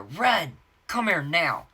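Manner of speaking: loud, high mock summons; husband yelling. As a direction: imitate an angry husband calling her over. Husband yelling for wife from elsewhere.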